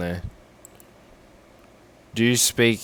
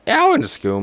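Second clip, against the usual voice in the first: indignant retort; 6 dB louder, faster than usual. Real, not acted. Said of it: "don't lie".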